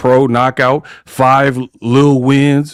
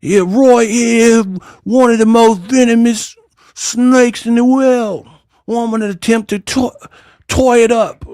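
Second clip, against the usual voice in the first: Crocodile Hunter impression; 7 semitones higher than usual. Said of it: "she out here fighting, uh, Crocodile Hunter".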